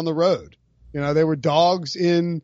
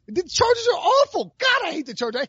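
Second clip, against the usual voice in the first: high ranting disgust; 10 semitones higher than usual. Real, not acted. Ranting hate at Chargers.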